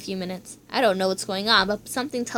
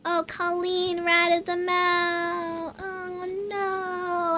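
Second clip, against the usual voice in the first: dismayed lament; 6 semitones higher, 4 dB quieter, much slower than usual. Acted. Reacting to betrayal.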